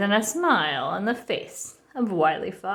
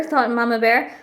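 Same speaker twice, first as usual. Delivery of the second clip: ordinary delivery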